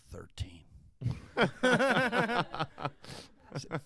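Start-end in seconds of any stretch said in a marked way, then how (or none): 0.1-0.7 whispered, ashamed admission; sheepish whisper. Retold or imitated. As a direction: whisper the score like you're embarrassed. Sheepish admission of failing grade.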